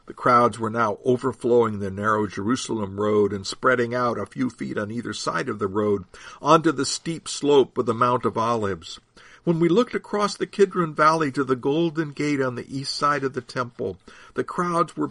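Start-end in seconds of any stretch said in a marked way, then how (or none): none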